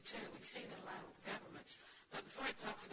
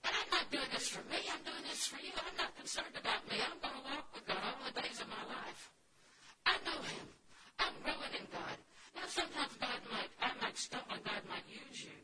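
loud, higher impassioned preaching; preacher declaring faith to the church; preaching at full force; 4 semitones higher, 7 dB louder than usual. Acted. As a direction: drive it home to the congregation.

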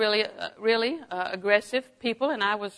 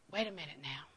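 very soft, lower-pitched demo; 4 semitones lower, 16 dB quieter than usual. Shown as a demonstration. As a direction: drop right down to a hushed, low voice. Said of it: "Lower your tone of voice".

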